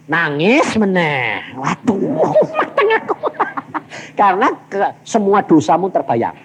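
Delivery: normal talking voice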